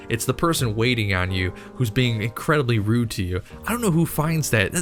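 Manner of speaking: normal talking voice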